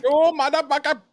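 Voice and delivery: very high, loud mock shriek; shrieked insult. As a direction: scream it in a squeaky put-on voice.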